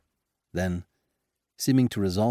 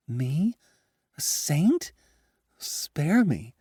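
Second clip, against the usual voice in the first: high, shocked dismay; alarmed; 8 semitones higher, much slower than usual. Acted. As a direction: recoil in horrified disbelief. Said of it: "hugged herself and went pale".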